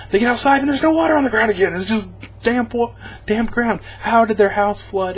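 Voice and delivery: high, fast, incredulous rant; exasperated. Real, not acted. Viewer ranting about the dry ground, high and fast. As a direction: rattle it off in disbelief. Exasperated retelling of plot hole.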